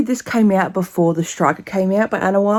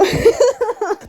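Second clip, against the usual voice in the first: high-pitched squealing laugh; high giddy laugh. Real, not acted. Reader loving her romance book, high giddy laugh. Overwhelmed by the book she loves.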